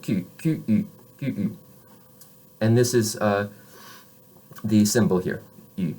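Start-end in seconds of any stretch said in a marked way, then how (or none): 0.0-1.3 Q said as French ku with rounded u; French letter names. Shown as a demonstration. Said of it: "use it to pronounce this consonant".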